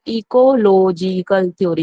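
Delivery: Ecological drawn out syllable by syllable; slow, deliberate repeat of ecological for students; slow dictation. Shown as a demonstration. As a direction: stretch out each syllable of ecological slowly. Repeats term slowly for students.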